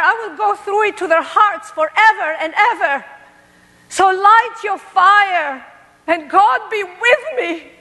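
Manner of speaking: dramatic stage reading, hushed then swelling to defiance; Joan of Arc monologue. Acted. Actress performing Joan of Arc's defiant speech for a stranger. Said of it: "Would you like me to do it for you?".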